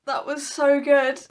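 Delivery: slow, choked-up praise through crying; real tears. Real, not acted. Just finished The Song of Achilles on camera, crying. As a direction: let the words wobble as the tears come. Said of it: "not while I'm crying".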